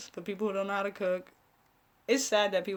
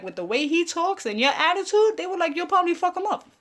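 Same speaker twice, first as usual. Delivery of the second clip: higher mock voice of the family; family warning; 6 semitones higher, 3 dB louder than usual. Family warning her about the show, mock higher voice. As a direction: voice her relatives scolding her, high-pitched.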